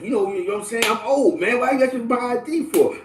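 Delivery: high-pitched mock complaint from an older customer; retold grumble pitched well up; indignant protest. Retells old customer protesting ID check.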